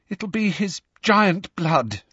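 high, slow, shaken voice; upset girl steadying herself; shaky. A narrator's reading. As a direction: say it haltingly, still rattled. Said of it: "said Hermione shakily".

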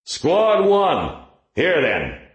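high bullhorn shout; through a megaphone. Acted. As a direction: shout orders over a bullhorn. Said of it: "Masters picked up a bullhorn".

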